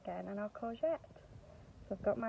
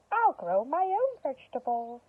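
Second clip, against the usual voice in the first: a touch of determined Winnie; louder, brighter resolve; lightly voiced witch; 5 semitones higher, 6 dB louder than usual.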